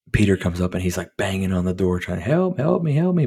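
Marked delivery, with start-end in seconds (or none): 2.2-3.3 high-pitched mock cry for help; a boy's high panicked call for help; pleading kid. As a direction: plead like a trapped boy banging on the door. Kid banging on door, pleading.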